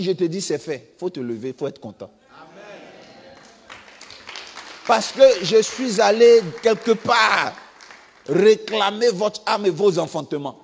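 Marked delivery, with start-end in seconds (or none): 4.9-10.6 much louder and higher, slow and forceful; fiery preacher declaring victory; preaching shout. Real, not acted. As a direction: proclaim it over the congregation.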